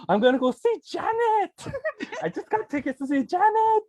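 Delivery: retelling her overjoyed reaction, high and giddy; giddy squeal. Retold or imitated. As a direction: squeal like a thrilled fan. Retold joy at [name] tickets.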